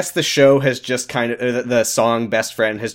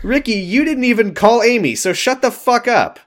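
high, raised-voice telling-off; loud high-pitched rant; mock furious scolding; 12 semitones higher, 3 dB louder than usual. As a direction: yell at him in exasperated outrage. Mock yelling at Ricky.

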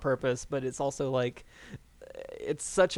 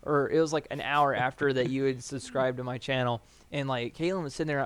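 ordinary delivery

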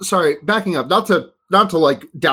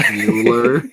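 lower, slow mock Mueller; 5 semitones lower than usual. Host imitates the old name Mueller, low. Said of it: "aping a Patrick name".

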